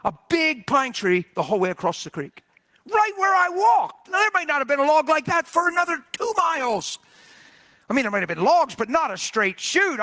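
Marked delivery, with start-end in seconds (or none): 2.9-7.0 amazed exclamation. Retold or imitated. Preacher telling hunters of the log he found, amazed exclamation. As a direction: raise it high in amazement. Amazed climax of his story.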